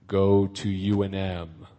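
low, slow, drawn-out voice of God; booming voice from heaven. Acted. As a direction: intone it slowly in a low God voice. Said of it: "in a big booming voice from heaven".

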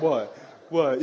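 low imitation of a tough gladiator; Ice. Gladiator standing over her opponent, imitated low taunt. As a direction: throw out short, low mock challenges. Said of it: "that's how she talked".

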